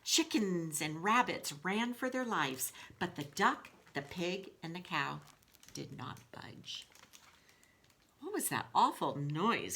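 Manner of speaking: lively storybook read-aloud to the class; reading to children. A narrator's reading. Teacher reading Wolf to her class in a storybook voice. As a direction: tell the story to the kids with expression.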